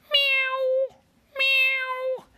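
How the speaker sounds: very high squeaky meows; cat meow. A narrator's reading. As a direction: mew like a little kitten. Frog now a kitty cat.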